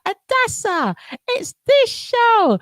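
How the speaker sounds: calling out a name way up high; high mock call-out. Listener screaming the show's name at the host, high mock call-out. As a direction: shout the name like a listener who just remembered. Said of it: "You'll be screaming it out".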